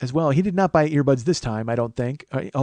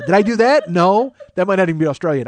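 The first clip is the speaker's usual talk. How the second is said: normal talking voice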